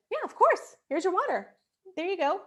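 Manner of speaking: high, bright pleased reply; cheerful mom. Retold or imitated. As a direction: reward her kindly. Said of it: "To which I said".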